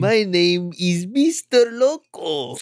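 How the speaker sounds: lightly voiced Mexican-accented alien; hint of Mexican accent introducing himself. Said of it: "Do a Mexican accent".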